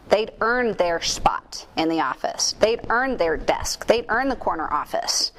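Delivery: "earned" leaned on each time, slow; stress on earned. Acted. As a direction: hammer "earned" in every line. Repeated emphatic earned.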